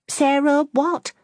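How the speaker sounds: high, slow, prying question; impatient curiosity. Acted. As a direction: drag it out, itching to know. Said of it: "with impatient curiosity".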